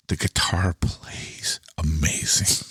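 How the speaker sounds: slow, hushed whisper of awe; awed whisper. Real, not acted. Telling the co-host how the guitar plays, slow awed whisper. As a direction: whisper it like a secret treasure.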